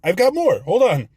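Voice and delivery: high, laughing rush to keep going; excited interruption. Real, not acted. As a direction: cut in eagerly, still laughing. Jumps back in laughing.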